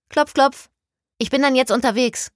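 high, loud call into the room; bright hello through the doorway. Acted. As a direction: sing out a cheery knock-knock. Calling out into the study.

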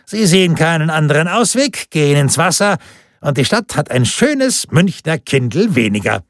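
pleased official closing the case; triumphant official. A narrator's reading. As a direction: explain it smugly, pitched high. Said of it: "rief er triumphierend".

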